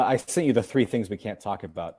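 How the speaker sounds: normal talking voice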